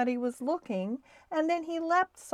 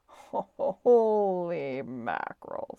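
slow, drawn-out amazement; astonished; 3 semitones lower, much slower than usual. A narrator's reading. Snowbell seeing Stuart rolled up in the shade, astonished. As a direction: stretch the words in astonishment. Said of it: "almost as surprised as Stuart".

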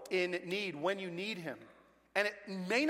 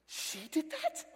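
surprised; 8 semitones higher, 3 dB quieter, much slower than usual. Retold or imitated. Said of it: "I looked at Zoila like".

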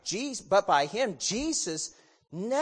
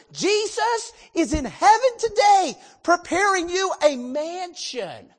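loud, raised preaching voice; 4 semitones higher, 5 dB louder than usual. Acted. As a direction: preach it out with full force. Preaches to the congregation.

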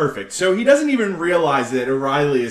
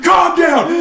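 sudden high shout, much louder; yelled Calm down; 11 semitones higher, 8 dB louder than usual. Retold or imitated. Therapist yelling at his patient to calm down. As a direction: yell it to stop a panicking man. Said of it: "starts yelling".

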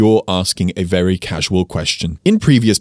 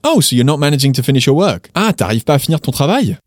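quick, higher-pitched surprised-style question; turned into question; 4 semitones higher, faster than usual. Shown as a demonstration. Teacher turning the statement into a question, quick and higher. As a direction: lift "travail" as a question after a quick "Ah". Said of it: "turn that into a question".

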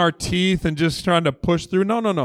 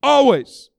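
proclaimed scripture; 7 semitones higher, 5 dB louder, much slower than usual. Acted. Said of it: "look what First Thessalonians says".

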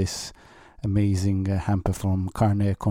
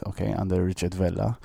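usual speaking voice

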